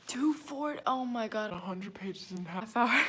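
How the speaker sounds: loud panicked exclamation; alarmed. Real, not acted. Realizing a hundred pages remain, she exclaims in alarm. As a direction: blurt it out in shock.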